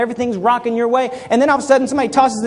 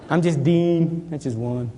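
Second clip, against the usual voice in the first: normal talking voice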